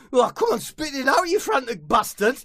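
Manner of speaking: high, loud impatient urging; high loud impatient footballer. Acted. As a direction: hurry him up, exasperated. Impatient friend.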